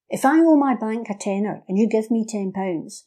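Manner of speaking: ordinary talk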